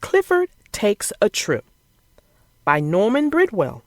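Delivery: put-on lively storybook narration for kids; reading to children. A narrator's reading. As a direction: read it like bedtime story time. Reads picture book to children.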